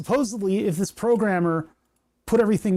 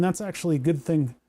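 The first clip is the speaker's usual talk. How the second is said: ordinary delivery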